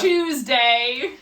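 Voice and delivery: loud mock British accent. Teasing co-host about British speech, mock British accent. Mocks British pronunciation of Tuesday.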